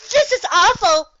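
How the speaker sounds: high, loud wail of despair. Acted. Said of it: "wailed the pink earth pony".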